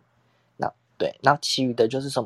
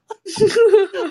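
sudden high loud burst of laughter; real embarrassed laugh; 10 dB louder than usual. Real, not acted. Laughing at drinking all six.

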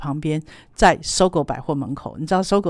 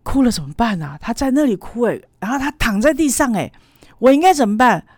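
worried mother; 5 semitones higher, 3 dB louder than usual. Retold or imitated. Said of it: "妈妈说".

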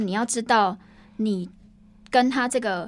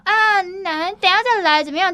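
lazy offhand put-off, pitched higher; mocking slacker attitude; 7 semitones higher, 4 dB louder than usual. Imitating a lazy coworker brushing him off, mock slacker tone. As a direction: toss it off like you can't be bothered. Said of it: "在摆烂的那种态度".

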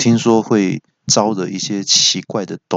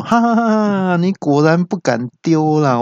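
gloating laugh; 7 semitones higher than usual. Acted. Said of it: "这个时候语音还不放过".

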